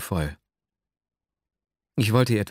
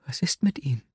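hushed, higher-pitched astonishment; astonished question; 6 semitones higher than usual. A narrator's reading. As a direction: ask almost in a whisper, taken aback. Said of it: "blickte mich erstaunt an". (erstaunt, fast geflüstert)

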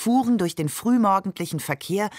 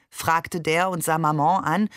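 usual speaking voice

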